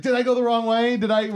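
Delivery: high-pitched confused guy; bewildered questioning. Acted. Counting man at the very end, bewildered questioning. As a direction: ask it high, baffled.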